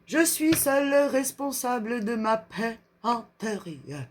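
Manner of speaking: slow French in a put-on English accent; slow, lilting mock-English accent. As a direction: play an English speaker reading French, drawn out. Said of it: "ce petit accent anglais".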